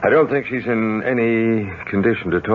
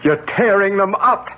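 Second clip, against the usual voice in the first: astonished; 10 semitones higher than usual. Acted. Doctor sees her rip photos.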